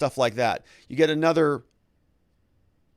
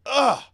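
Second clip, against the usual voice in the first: disgusted groan; 11 semitones higher, 5 dB louder than usual. Real, not acted. Disgust after torpedo joke.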